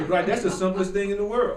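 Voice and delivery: ordinary talk, nothing marked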